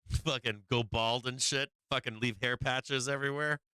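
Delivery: normal talking voice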